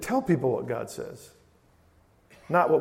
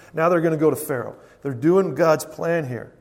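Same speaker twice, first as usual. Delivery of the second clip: normal talking voice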